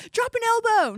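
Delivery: loud, high mock yelling parent; pushy sports parent. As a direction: yell it like an overbearing coach parent. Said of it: "they were like".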